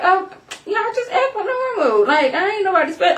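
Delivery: high-pitched mock exasperation; whiny, overwhelmed appeal; exasperated protest. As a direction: plead to be left alone, hammed up. Acted Michael protests being fussed over.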